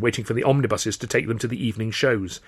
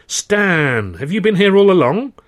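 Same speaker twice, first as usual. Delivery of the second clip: cheerful greeting; 9 semitones higher, 8 dB louder, slower than usual. Acted. Hearty greeting to old friend.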